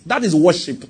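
ordinary talk

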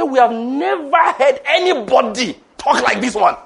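loud, high and slow, every word driven home; emphatic quoted amazement. Retold or imitated. Preacher voicing the board's verdict on the speaker, loud and emphatic.

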